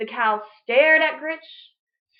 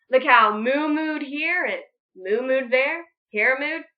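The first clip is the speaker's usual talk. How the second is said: ordinary delivery, nothing marked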